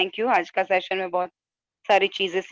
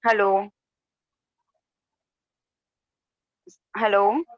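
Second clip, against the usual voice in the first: louder hello into a call; over the phone; 3 semitones higher, 4 dB louder than usual. Real, not acted. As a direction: keep calling hello down the line. Caller unheard on online session.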